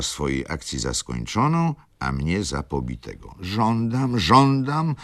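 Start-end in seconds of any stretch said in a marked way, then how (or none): 3.4-4.9 higher, louder insistent żądam; sighing demand. Acted. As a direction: repeat żądam rising in force. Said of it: "mówiła wśród westchnień".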